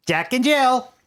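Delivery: high-pitched announcement in a put-on voice; Mago. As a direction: pitch it well up, like a recited signal. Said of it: "the Mago goes".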